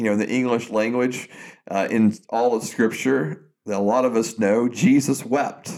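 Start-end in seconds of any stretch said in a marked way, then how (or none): none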